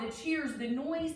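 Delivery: ordinary delivery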